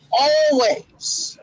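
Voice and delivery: ordinary talk, nothing marked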